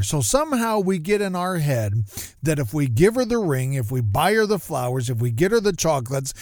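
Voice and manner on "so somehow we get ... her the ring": ordinary talk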